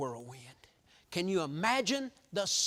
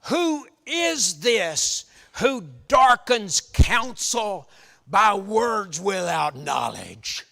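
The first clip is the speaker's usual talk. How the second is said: slow, much louder booming proclamation; thunderous voice of God; 9 dB louder, much slower than usual. Acted. Preacher voicing God speaking to Job from the whirlwind, booming. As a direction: boom it out slowly like thunder from a storm. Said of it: "with that thunderous voice".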